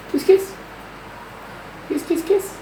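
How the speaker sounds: very high coaxing kiss-kiss; squeaky kiss calls. Acted. Owner asking lorikeet for kisses, very high. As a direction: coo it up high to the bird.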